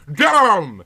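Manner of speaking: loud, high hammed-up curse; cursing. [name] pounds the table and curses loudly. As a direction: spit out a mock-furious curse. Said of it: "she cursed".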